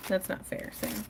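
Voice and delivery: ordinary talk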